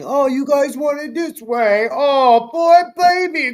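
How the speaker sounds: high, loud baby-talk mockery; mocking baby talk. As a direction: talk down to the fans like babies. Mocking WWE's message to fans.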